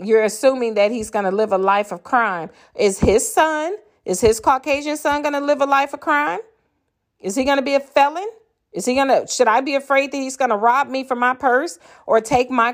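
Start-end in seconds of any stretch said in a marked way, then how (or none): 2.7-10.2 incredulous. Real, not acted. Mocking the cop's logic.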